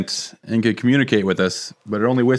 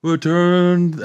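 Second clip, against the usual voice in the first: Courage the Cowardly Dog voice; 6 semitones higher than usual. Retold or imitated. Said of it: "that kinda Courage the Cowardly Dog voice".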